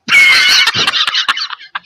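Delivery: high, loud cackling laugh of the wife; wife laughing at husband, high cackle; mocking laugh. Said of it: "And her response was".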